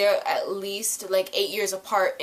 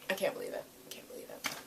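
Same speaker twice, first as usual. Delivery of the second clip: breathless whisper of disbelief; shocked whisper; 14 dB quieter, much faster than usual. Real, not acted. Reacting to the episode, a stunned whisper.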